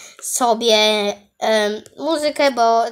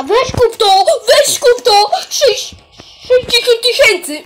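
high, loud pushy cheering; excited urging; 9 semitones higher, 3 dB louder than usual. Real, not acted. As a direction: egg him on to buy. Urging friend to buy.

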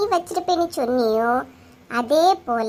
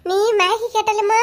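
normal talking voice

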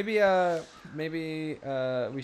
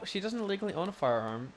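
usual speaking voice

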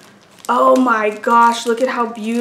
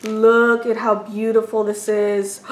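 ordinary talk, nothing marked

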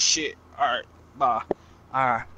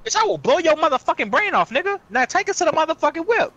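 angry threat; 8 semitones higher than usual. Acted. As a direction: threaten him to get the car back. Threatening the car thief.

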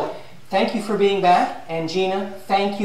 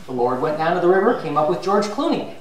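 ordinary talk

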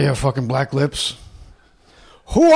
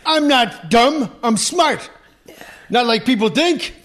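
Godfather impression; 12 semitones higher, 4 dB louder than usual. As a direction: rant about Fredo, much higher and louder. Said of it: "in The Godfather".

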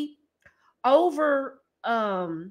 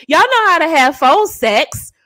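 ordinary talk

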